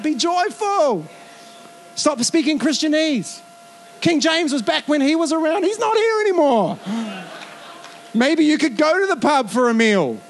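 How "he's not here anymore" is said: laughing punchline. Real, not acted. Preacher joking to the congregation about King James, laughing.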